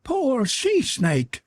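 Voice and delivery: very high, slow scornful screech; snapping creature. A narrator's reading. Creature cuts in on the lamenting girl, snapping. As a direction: sneer it slowly in a high monster voice. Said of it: "snapped the Weru".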